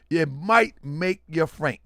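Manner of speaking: normal talking voice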